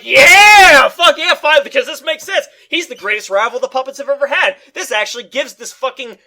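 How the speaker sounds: shouted high cheer, then fast eager rant; excited. Pretend. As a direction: yell like a fan finally satisfied. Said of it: "I would have been like".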